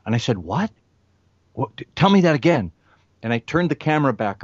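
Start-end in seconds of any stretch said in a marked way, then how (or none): 0.4-2.7 surprised. Retold or imitated. Stunned by her remark.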